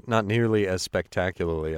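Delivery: ordinary delivery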